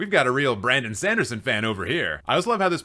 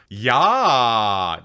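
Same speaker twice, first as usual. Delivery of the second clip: put-on long, loud German-accented "ja"; 3 semitones lower, 5 dB louder than usual. Answering a one-word movie review, German accent. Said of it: "in a German accent".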